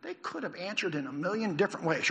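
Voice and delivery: usual speaking voice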